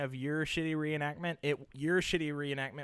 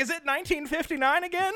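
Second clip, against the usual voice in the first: put-on high, amused old lady voice; man doing a woman's voice; 12 semitones higher, faster than usual. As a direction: squeak it out like a startled mom. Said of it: "She's like".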